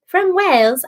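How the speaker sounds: unsure mock Welsh accent; attempted Welsh accent. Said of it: "shouldn't even try to do an accent".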